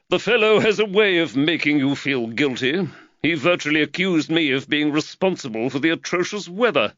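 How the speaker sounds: a hint of a harried employer fretting over costs; Reed the magistrate. A narrator's reading. Reed voiced by narrator.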